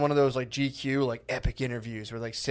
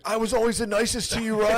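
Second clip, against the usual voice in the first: Tom Hanks; 8 semitones higher than usual. Pretend. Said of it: "Tom Hanks is holding his, like, dying body".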